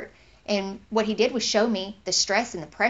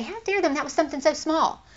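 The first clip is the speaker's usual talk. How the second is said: higher-pitched mock indignation; indignant; 7 semitones higher than usual. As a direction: act out someone taking offense, voice jumping up. Imagined indignant reply of offended person.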